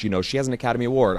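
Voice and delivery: usual speaking voice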